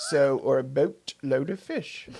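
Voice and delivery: slow, caricatured Canadian; slow it down in a mock Canadian accent. Shown as a demonstration. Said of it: "going to do my Canadian now".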